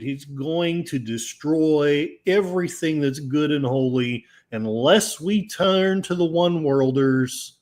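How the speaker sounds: ordinary talk, nothing marked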